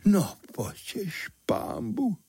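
high, slow, part-whispered dismay; aghast exclamation. A narrator's reading. Chimney sweep learns who his guest is, aghast. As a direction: let it slip out, appalled. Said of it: "vyjelo z něj".

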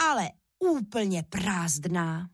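plain reading voice

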